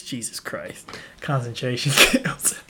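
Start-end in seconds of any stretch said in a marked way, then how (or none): 0.0-0.9 breathy whisper under the breath; whispered aside. Real, not acted. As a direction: murmur it to yourself in disbelief. Shocked aside after a grim thought.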